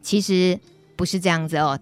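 ordinary delivery

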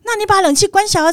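imitating the breezy repairman; mechanic. Repairman casually advising her on the phone, high mock voice. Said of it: "他说".

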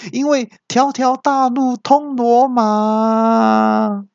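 高亢缓慢的导航机腔调; 拉长音，高高地慢慢回嘴; 大声回嘴. Acted. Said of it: "他也很大声的回我说". (导航机拖长高音回嘴)

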